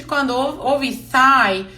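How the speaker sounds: ordinary talk, nothing marked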